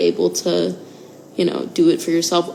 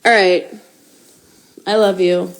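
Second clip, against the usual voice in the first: usual speaking voice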